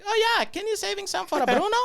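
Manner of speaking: very high, loud, fast put-on voice; imitated coworker. Coworker relaying the name to her son, high loud mock voice. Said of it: "Just for her to, like".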